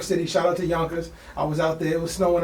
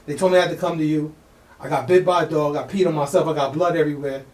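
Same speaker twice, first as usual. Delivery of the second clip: normal talking voice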